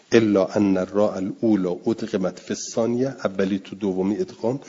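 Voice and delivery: normal talking voice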